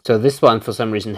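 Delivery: ordinary delivery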